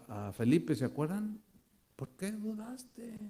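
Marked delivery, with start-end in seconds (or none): 2.0-3.2 gentle reproach. Retold or imitated. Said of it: "Jesús les reclamó".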